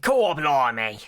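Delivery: loud hammed-up British exclamation; broad put-on British accent; mock British accent. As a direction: belt out a cartoon Brit's surprise. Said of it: "in a posh British accent".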